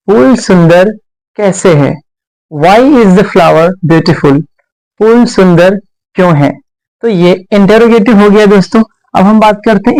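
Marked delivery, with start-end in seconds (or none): 2.5-4.4 very slow, word by word; dictating to a class; slow dictation. Shown as a demonstration. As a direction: spell it out slowly for students. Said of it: "teacher dictating slowly".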